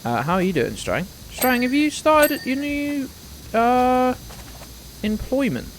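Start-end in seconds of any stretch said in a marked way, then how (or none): 1.3-5.7 much higher, lilting question; a sudden high sing-song ask; odd high sing-song. Real, not acted. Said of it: "Why am I saying it like that?".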